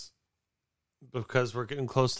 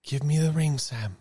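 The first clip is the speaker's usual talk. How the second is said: Frodo voice; 6 semitones higher, 5 dB louder, slower than usual. Acted.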